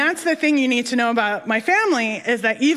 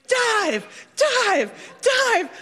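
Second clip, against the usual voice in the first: mock crowd shouting; 11 semitones higher, much slower than usual. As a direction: imitate a crowd urging someone on. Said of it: "they're all shouting".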